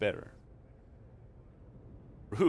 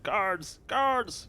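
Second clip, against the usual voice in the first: high, loud alarm call for the guards; 12 semitones higher, 6 dB louder than usual. Acted. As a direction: shout for the guards, rising louder the second time. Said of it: "Then she calls out".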